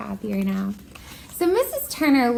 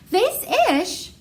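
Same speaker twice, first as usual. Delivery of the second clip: puzzled echo; 9 semitones higher than usual. Acted. Ramon puzzled question.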